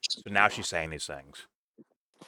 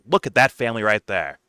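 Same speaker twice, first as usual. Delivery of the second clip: angry confrontation; 2 semitones higher, 9 dB louder than usual. Acted. Host imagines making Kyle look at the victims, angry and loud. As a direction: confront him forcefully, voice rising to the last word.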